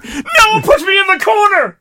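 high-pitched whiny mock outburst; playfully overdramatic, squealed complaint; mock protest. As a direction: wail it like a hammed-up victim. Mock protest at being picked on.

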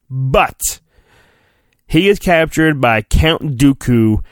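ordinary talk

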